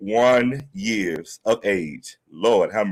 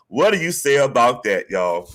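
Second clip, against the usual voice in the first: usual speaking voice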